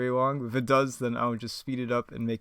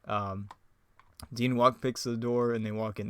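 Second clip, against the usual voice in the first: ordinary talk, nothing marked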